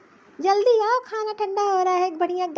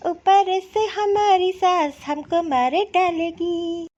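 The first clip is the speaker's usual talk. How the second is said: ordinary talk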